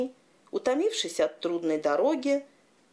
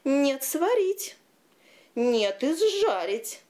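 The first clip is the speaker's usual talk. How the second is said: high, slow, louder arguing heads; bickering giant shout; 7 semitones higher, 3 dB louder, slower than usual. A narrator's reading. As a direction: trade stubborn shouts. Two heads argue.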